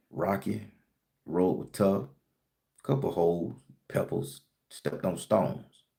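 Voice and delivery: put-on an old-timer recounting a rough road, worn out; weary trudging reply. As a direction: let each hardship drop tiredly. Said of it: "I'm gonna be talking like this".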